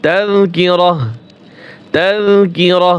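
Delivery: তাযকিরাহ slowly, ending on a breathed h; waqf stop. Shown as a demonstration. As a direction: close তাযকিরাহ on h in place of "tun". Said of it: "এই তা হা উচ্চারণ হবে". (ধীরে, শেষে "হা" দিয়ে তাযকিরাহ)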